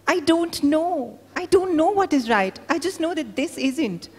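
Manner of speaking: desperate mother pleading with her husband; anguished cry. Acted. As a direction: cry out in helpless despair. Wife's anguished reply.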